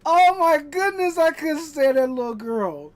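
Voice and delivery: loud exasperated cry; exasperated outburst. Real, not acted. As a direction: exclaim in disbelief. Exasperated outburst about Veruca.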